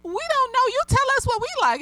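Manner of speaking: imitating kids. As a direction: squeak it out like a cheeky child. Students answering their teacher.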